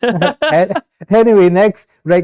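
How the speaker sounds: high, laughing attempt to move on; real laughing. Real, not acted. Getting back to the recap, laughing. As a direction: push on through the giggles.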